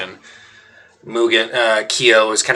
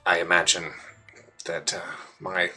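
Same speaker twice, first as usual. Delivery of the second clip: softer, hushed grim news; hushed aside; 5 dB quieter than usual. Acted. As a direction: keep it from the children's ears. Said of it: "covers Mugen's ears".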